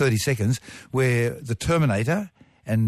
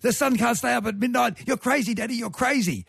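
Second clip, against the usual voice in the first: high-pitched imitation of a protesting child; squeaky-high retold kid's protest; young daughter; 12 semitones higher than usual. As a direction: mimic an incredulous little girl, much higher. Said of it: "and my daughter said".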